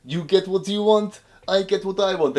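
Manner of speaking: high, loud mock Russian boast; gloating Russian accent. Krichkov gloating to the Wishmaster, high mock Russian. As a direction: crow over the deal.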